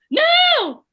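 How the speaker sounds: high, loud little-brother protest; Billy. Retold or imitated. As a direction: shout it shrilly high, stretched out like a defiant kid. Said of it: "in his Billy voice".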